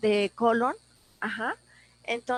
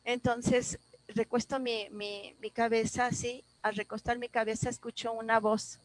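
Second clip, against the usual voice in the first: ordinary delivery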